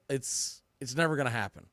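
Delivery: normal talking voice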